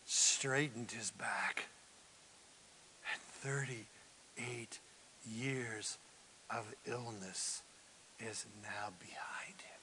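slow, soft, near-whispered telling; slow it down and let it land; hushed narration. A narrator's reading. Hushed storytelling.

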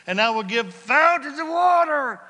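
loud, high ringing cry; preaching shout. Real, not acted. Preacher crying out the promise to the church. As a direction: call it out to the back row.

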